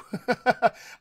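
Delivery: light burst of laughter. Real, not acted. Coach amused by own rhetorical question, laughing. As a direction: let out a quick run of chuckles.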